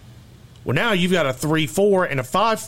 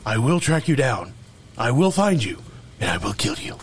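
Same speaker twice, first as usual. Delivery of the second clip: friend joins in with the Taken phone threat; Liam Neeson impression; 3 semitones lower than usual. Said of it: "terrible Liam Neeson impersonation".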